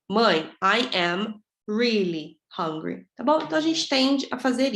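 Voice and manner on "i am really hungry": slow, separated "I am", drawn-out "really"; emphatic full form. Shown as a demonstration. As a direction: stretch "I am really" for emphasis before "hungry". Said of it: "usa o tom de voz pra dar ênfase". (ênfase lenta em "I am really", palavra por palavra)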